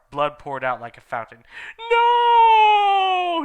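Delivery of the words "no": high piercing shriek; scream. Acted. Stabbed villain dying in agony, high piercing scream. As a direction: scream it as he dies. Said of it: "he screamed".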